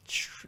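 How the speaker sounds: "Tr-" as a soft, hushed "chr"; exaggerated English TR. Shown as a demonstration. Teacher isolating the English sound, "Tr-" as "chr". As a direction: stretch "Tr-" into a slushy "chr". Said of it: "I'm exaggerating that".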